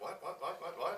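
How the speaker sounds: high rapid-fire questioning; imitating startled, curious kids; sudden mock curiosity. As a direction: pipe up high like heads popping up. Students suddenly pop up interested.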